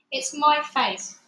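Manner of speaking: ordinary delivery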